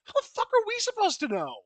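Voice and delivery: high-pitched indignant retort; exasperated. Real, not acted. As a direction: throw the question back, fed up. Exasperated reply to quoted question.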